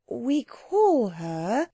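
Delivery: very slow, measured phrasing; careful. A narrator's reading. Anthea explaining to the learned gentleman, carefully. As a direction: feel the way cautiously through each word. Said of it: "said Anthea carefully".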